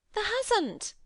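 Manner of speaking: shocked, can't believe it; exclaimed. A narrator's reading. Martha exclaims at Mary's news, shocked Yorkshire. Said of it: "she exclaimed".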